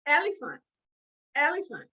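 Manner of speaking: ordinary delivery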